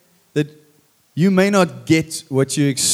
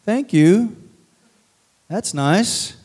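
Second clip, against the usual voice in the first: polite fake thanks; 5 semitones higher, slower than usual. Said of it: "you go".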